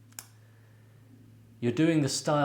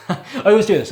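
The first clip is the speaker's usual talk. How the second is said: laughing through the words; giggling while talking; 10 semitones higher, 15 dB louder than usual. Real, not acted. Laughs at own habit.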